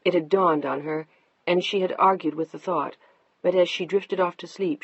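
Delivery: usual speaking voice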